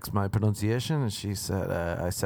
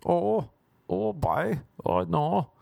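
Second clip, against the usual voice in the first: a higher mock Irish exclamation; Irish accent; 8 semitones higher, much slower than usual. Friends in the back seat react, mock Irish accent. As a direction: toss off a put-on Irish oh no. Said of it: "Doing their Irish accents".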